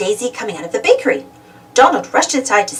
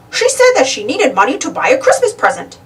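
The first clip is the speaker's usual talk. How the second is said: cheery shopkeeper; 5 semitones higher than usual. A narrator's reading. Bicycle shopkeeper answering Donald's question, cheery. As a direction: chirp it like a helpful clerk.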